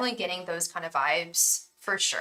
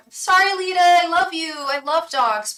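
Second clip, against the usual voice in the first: high, loud, hurried apology; flustered high apology; 9 semitones higher, 8 dB louder than usual. Real, not acted. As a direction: blurt out the sorry, flustered. Hasty apology to dog lover.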